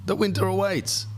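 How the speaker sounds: The winter awaits intoned high like a grim prophecy; mock-portentous, high-pitched repeat; ominous echo. Shown as a demonstration. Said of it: "soon the winter awaits".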